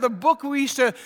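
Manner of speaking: normal talking voice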